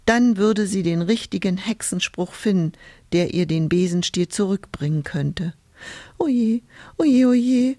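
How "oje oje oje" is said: high, wailing lament; tearful moan, pitched up; crying. A narrator's reading. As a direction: wail it in mock tears. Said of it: "Hulda weinte wieder".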